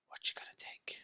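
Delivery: breathy whisper, no voice. Acted. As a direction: lean in and whisper it. Matrix pill question.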